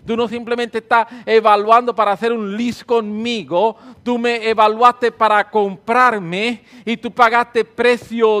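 loud fervent prayer, slowing at the end; worship prayer. Real, not acted. Preacher leading congregation in praise, fervent prayer. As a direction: lead the church in praise.

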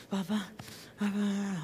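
soft, barely-there babbled prayer; mumbled babbling. Retold or imitated. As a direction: babble it under your breath like a weeping woman. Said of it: "hablando, balbuceando".

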